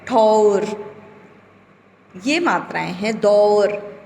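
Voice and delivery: ठौर, दौर with an open औ; teacher voicing the औ; clear औ vowel. Shown as a demonstration. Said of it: "उसे बोलने में भी सुनाई दे रहा है".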